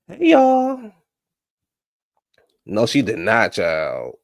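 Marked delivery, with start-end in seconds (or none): 0.1-0.9 much higher, loud sing-song hey y'all; loud high-pitched hello to the viewers; high sing-song greeting. Acted.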